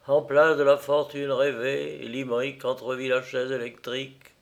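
ordinary delivery

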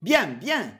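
ordinary delivery

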